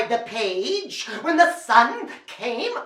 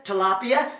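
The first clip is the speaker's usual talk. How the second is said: ordinary delivery, nothing marked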